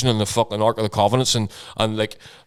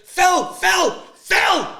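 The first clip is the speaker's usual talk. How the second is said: wife yelling his name; much slower than usual. Retold or imitated. Wife shouting after Phil as the car pulls away. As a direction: call him urgently from the door. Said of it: "She's like".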